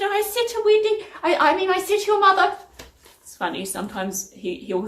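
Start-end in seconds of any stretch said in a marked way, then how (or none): 0.0-2.6 high-pitched imitation of a flustered dad; Dad correcting his own slip, voiced way up high; dad impression. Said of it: "He said".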